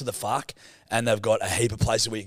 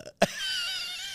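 very high squeal of laughter; laughing; 4 dB quieter than usual. Real, not acted. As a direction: burst into a high-pitched giggle. Cracking up at teasing.

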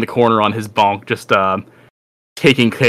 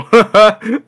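high, loud monster laugh; big mocking laugh; 11 semitones higher, 6 dB louder than usual. Gray monster laughing at the fight, high and loud. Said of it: "the gray guy go".

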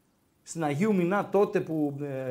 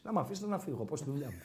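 1 cheeky quiet reply; 4 semitones lower, 10 dB quieter, faster than usual. Retold or imitated. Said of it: "και λέω".

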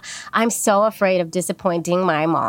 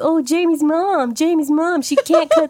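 mocking teasing; 9 semitones higher than usual. Friends mocking the good girl, higher and sing-song. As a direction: tease her like a snotty schoolfriend. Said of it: "they would make fun of me".

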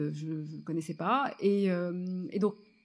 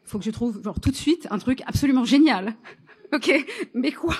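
loud, high mock panic; retold frantic urgency; 4 semitones higher, 8 dB louder than usual. Writer urging herself to find a brilliant idea, mock-frantic. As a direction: push louder and higher, breaking into a laugh.

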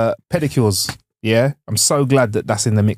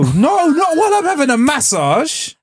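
whiny pleading; slower than usual. Pretend. Mock pleading against chatty masseuse.

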